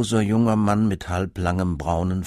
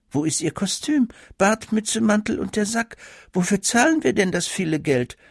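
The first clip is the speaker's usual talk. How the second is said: much higher, indignant disbelief; aghast; 11 semitones higher than usual. A narrator's reading. Mother scolds the student for having no costume, aghast and high. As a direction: demand where the costume is, outraged. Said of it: "fragte die Mutter entgeistert".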